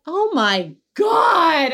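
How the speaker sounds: slow, high shocked cry. Real, not acted. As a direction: gasp it out in disbelief. Shocked reaction to report.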